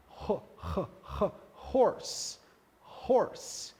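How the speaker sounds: drawn-out breathy "ho-, ho-" then "horse"; exaggerated aspirated h. Shown as a demonstration. Teacher exaggerating the breathy H in "horse". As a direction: stretch the H of "horse" into a puff. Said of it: "um som aspirado".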